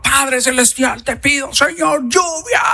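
high, drawn-out cry to heaven; fervent prayer. Acted. Elijah begging God for rain, fervent high cry. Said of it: "seguramente oraba".

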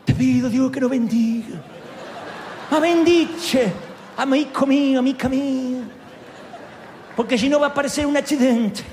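loud, high hammed-up mafia-boss Italian accent; Godfather Italian. Preacher imitates Cornelio as the Godfather, Italian accent. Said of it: "más tipo El Padrino".

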